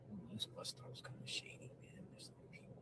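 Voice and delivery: hushed whisper, wary. Real, not acted. Streamer whispering warily about a deserted bus stop, hushed whisper. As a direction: whisper it, as if someone might hear.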